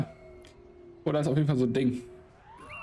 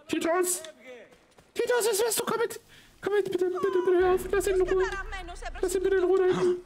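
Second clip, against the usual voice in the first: mock frantic pleading. Frantic calls to fleeing NPC.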